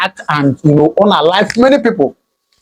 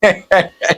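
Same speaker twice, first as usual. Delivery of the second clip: scornful chuckle; 3 dB quieter than usual. Real, not acted. Commentator reacting to call for a shooting, scornful chuckle. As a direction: chuckle in disbelief at the claim. Scornful laugh at the shocking claim.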